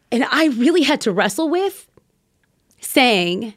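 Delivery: ordinary talk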